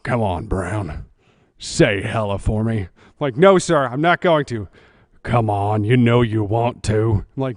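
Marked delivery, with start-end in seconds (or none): 0.0-2.8 low coaxing boss voice; lightly voiced boss, sly and low. Boss needling him to say hella, low and coaxing. 3.4-4.7 loud firm no sir; firm refusal. Retold or imitated. Recruit refusing his boss, loud and firm. 5.2-7.2 low teasing boss voice; lightly voiced boss, sly and low; boss coaxing. Boss coaxing the recruit, low and teasing.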